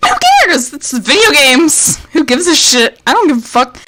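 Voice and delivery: loud, fed-up ranting shout; furious shout. Real, not acted. Streamer yelling at chat about the game, loud furious rant. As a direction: blast it out, done with the game. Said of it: "I've never felt rage like this".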